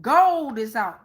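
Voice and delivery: ordinary talk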